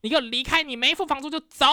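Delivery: mock angry eviction orders; retelling her ordering the guests out one by one; ordering them out. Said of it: "我就说".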